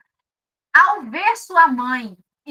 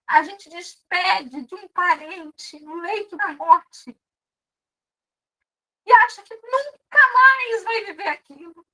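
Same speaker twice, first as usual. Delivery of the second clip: high, loud impassioned preaching; preaching at full force; 6 semitones higher, 4 dB louder than usual. Acted. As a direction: pour your heart into the plea. Preaching climax. (pregação inflamada, com voz alta e aguda)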